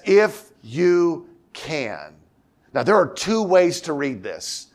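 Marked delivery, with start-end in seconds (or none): 0.0-2.2 drawn out, slow if you can, can stretched long; slow echo. Acted. Jesus echoing the father's words, drawn out slow. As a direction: hand the words back slowly. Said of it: "Jesus turns to the Father and says".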